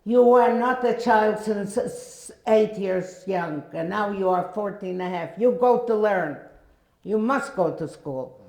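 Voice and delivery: loud scolding, dragged out then quick; yelling. Retold or imitated. Sister yelling at the teenager that she must go to school. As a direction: scold her firmly, no arguing. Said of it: "And she was yelling at me".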